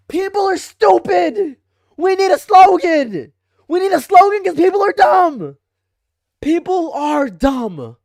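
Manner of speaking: high, loud exasperated yelling; exasperated shout. Real, not acted. Streamer ranting to chat about slogans, shouting in exasperation. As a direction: blast it out in frustration.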